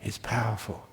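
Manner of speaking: ordinary delivery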